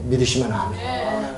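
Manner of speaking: ordinary delivery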